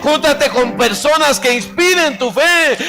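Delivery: building to loud, high, ringing exhortation; full-force preaching. Real, not acted. Preacher urging the congregation to keep company with people of faith, full-force preaching.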